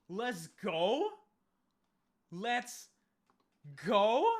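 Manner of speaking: loud, high, rising yell; excited cheer. Real, not acted. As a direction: shout it in celebration, pitch climbing at the end. Celebrating a lucky encounter.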